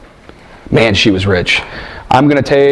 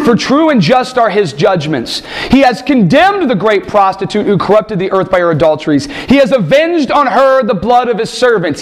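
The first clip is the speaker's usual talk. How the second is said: raised, ringing reading of the vision; scripture reading; 6 semitones higher than usual. Acted. Preacher reads Revelation aloud to the congregation, ringing and raised. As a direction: proclaim the text to the congregation.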